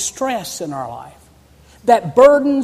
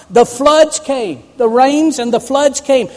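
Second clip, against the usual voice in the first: emphatic preaching; 4 semitones higher, 6 dB louder than usual. Acted. Preacher builds the storm image.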